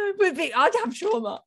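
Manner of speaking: a hint of babyish self-pity; mock whiny plea. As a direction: whine it like a wronged child. Mocking plea, high voice.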